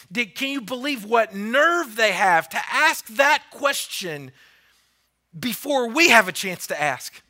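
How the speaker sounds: loud, slowed outrage; indignant. Acted. Ten disciples fuming at James and John, loud indignant. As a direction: spit out the disbelief, red hot mad. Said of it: "they were indignant, red hot mad".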